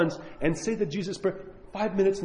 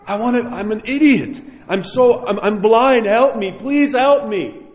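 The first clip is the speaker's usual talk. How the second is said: raised, pleading cry to God; mock weeping plea; 5 semitones higher, 5 dB louder than usual. Shown as a demonstration. Priest shows weeping before God, raised pleading. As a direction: beg for help from the heart. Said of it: "just weep before God and say".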